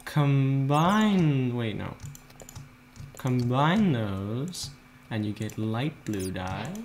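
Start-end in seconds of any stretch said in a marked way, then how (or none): none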